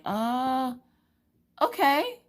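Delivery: high, hesitant "uh, okay"; bewildered little reply; baffled compliance. Retold or imitated. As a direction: agree uncertainly, pitch climbing. Retold baffled reply to bossy stranger.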